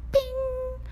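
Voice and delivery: high sung bing like a text alert; phone ding sound; mock notification ding. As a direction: chirp it like a phone ping.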